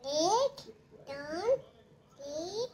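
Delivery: mother softly counts to prompt her son; hushed counting. Shown as a demonstration. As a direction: model the count for the toddler in a hush. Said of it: "one, टू, three बोलायचं".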